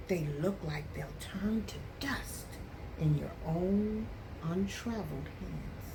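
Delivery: plain reading voice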